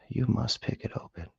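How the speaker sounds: voiceless whisper, mock insistent; whispered ego voice. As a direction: hiss it like a nagging inner voice. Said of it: "Me saying".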